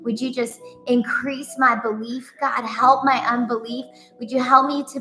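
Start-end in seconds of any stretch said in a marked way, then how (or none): none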